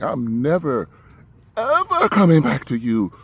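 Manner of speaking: real heated refusal, hammering the words out slowly; angry vow. Real, not acted. Patient vowing never to return to the hospital, angry.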